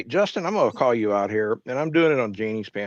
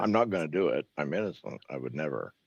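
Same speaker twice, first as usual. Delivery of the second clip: softer, fading mock denial; hushed fake protest of innocence; mock innocent protest; 7 semitones lower, 8 dB quieter than usual. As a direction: play it falsely innocent, trailing off. Mocking Justin's protest.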